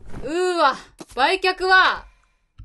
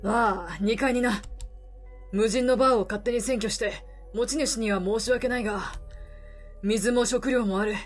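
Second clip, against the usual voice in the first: put-on gruff weary older man, low; lower middle-aged man voice; 4 semitones lower, 6 dB quieter than usual. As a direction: speak low like a tired drifter. Voices the reporter.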